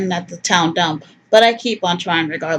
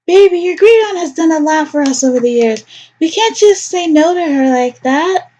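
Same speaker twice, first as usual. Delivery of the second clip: higher coaxing mother voice; lightly higher doting mother; Lorraine pleading; 8 semitones higher than usual. Acted. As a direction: sweet-talk your son into going. Player voices Lorraine to her son.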